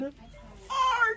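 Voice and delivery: high mock boo-hoo wail; mother boo-hooing over her hairdo. A narrator's reading. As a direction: wail it like a pretend crybaby. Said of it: "boo-hooed Ava's mother".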